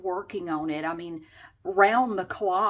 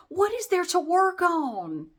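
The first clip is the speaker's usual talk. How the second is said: exasperated repeat; 8 semitones higher, 4 dB louder, slower than usual. Retold or imitated. Repeating the line, louder.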